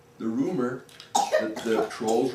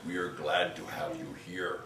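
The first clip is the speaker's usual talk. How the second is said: low, soft, friendly troll; troll inviting the ghost to a game, low and soft; 6 semitones lower, 7 dB quieter than usual. Acted. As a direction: invite him to play like a shy giant. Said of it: "the next troll stepped forward and he said".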